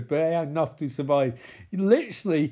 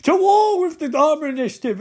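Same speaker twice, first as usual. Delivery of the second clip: high, loud mock war cry; rally the tribe with a hammed-up shout; acted rallying yell; battle cry; 9 semitones higher, 7 dB louder than usual. Acting leader's battle cry.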